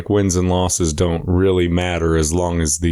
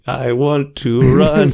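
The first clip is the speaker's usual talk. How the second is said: mock exhausted voice; 7 semitones higher, 4 dB louder, slower than usual. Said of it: "we're like".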